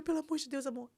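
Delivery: high, pleading re-enactment; retold desperation. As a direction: beg a husband, as if panicked. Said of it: "Eu desesperei". (súplica desesperada, revivida)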